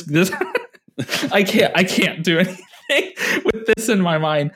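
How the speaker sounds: words broken up by laughter; giggling I can't; laughing disbelief. Retold or imitated. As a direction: laugh through the quote, barely holding it together. Retelling own broken-brain reaction.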